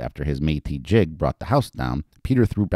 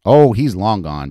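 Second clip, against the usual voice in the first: laughing; 4 semitones higher, 8 dB louder, slower than usual. Acted. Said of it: "Betty laughed".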